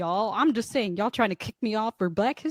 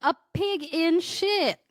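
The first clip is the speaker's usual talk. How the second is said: slow, higher Southern country drawl; country accent; 7 semitones higher than usual. Shown as a demonstration. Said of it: "She sounded country as fuck saying it".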